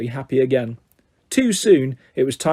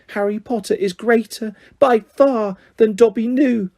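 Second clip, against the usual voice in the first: sobbing Dobby; 6 semitones higher than usual. A narrator's reading. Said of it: "he sobbed".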